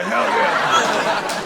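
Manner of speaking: hammed-up delighted hell yeah; eager hell yeah. Customer thrilled at the swap, cheering.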